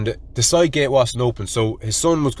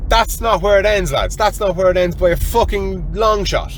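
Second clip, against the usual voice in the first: higher-pitched, emphatic storyteller's build-up; excited; 8 semitones higher than usual. Real, not acted. Telling the lads the story is far from over, excited. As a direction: announce the twist with rising excitement.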